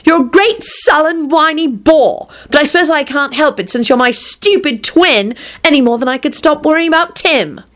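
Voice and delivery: high-pitched sibling rant; snappish exasperation. A narrator's reading. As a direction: berate your twin, pitch held high. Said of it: "snapped Rosie".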